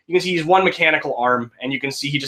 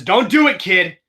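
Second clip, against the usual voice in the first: urgent plea; 7 semitones higher, 4 dB louder than usual. Acted. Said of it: "Eddie just says".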